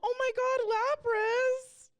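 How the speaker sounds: very high, loud delighted squeal; excited squeal. Real, not acted. Gushing to co-host over a Pokemon, excited squeal.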